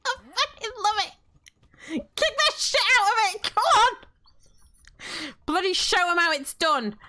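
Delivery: high, loud, rowdy egging-on; excited cheering. Real, not acted. Player egging on a fight, high excited shouts. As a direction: cheer them on, pitched right up.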